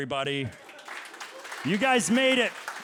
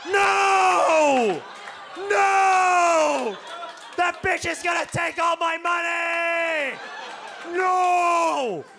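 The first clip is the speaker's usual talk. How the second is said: high, loud, desperate NO; hammed-up orgasm shriek; panicked male orgasm scream; 10 semitones higher, 6 dB louder, much slower than usual. Shown as a demonstration. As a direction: scream no like losing everything. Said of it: "it goes a little something like this".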